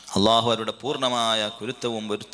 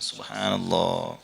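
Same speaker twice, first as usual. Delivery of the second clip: ordinary talk, nothing marked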